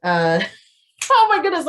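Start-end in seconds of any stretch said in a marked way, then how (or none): 0.8-1.7 flustered laugh, higher and louder; laughing exclaim. Real, not acted. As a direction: laugh it out while caught off guard. Flustered laugh forgetting book name.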